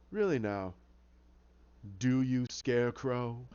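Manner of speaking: low, slow, taunting villain; sinister villain. Acted. Hatter mocking Scarecrow at the asylum, sinister. Hatter menacing Jonathan Crane.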